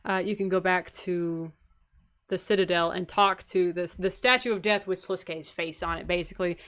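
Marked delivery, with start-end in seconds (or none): none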